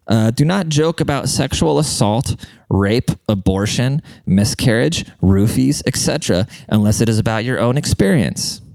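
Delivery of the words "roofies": usual speaking voice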